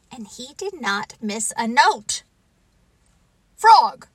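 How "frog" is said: high, loud call of a name; crying out. Acted. Toad on stage calling to his friend, loud cry. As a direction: call out sharply to the audience. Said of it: "cried Toad".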